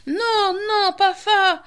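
high, desperate witch cry. A narrator's reading. The witch seeing the children return, high desperate cry. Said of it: "cria la sorcière".

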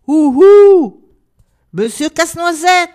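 loud, high holler up a tree; calling out. A narrator's reading. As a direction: call to someone far above. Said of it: "et appela". (appel lancé très fort)

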